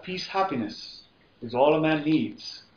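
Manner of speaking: ordinary delivery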